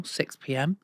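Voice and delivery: normal talking voice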